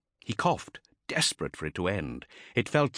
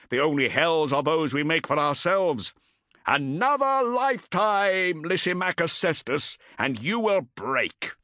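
shouting taskmaster; 8 semitones higher than usual. Acted. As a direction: bark the challenge. Taskmaster condemns Cestus.

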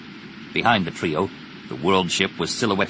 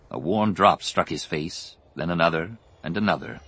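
plain reading voice

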